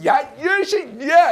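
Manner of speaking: drawn-out mimicked scolding yell; imitated angry outburst. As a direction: act out a boss blowing up. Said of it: "이러면서 화를 내셨다고".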